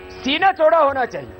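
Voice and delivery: ordinary delivery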